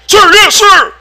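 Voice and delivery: very high, loud recruit's shout. Acted. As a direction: scream it back like a raw recruit. Recruit answering the drill sergeant.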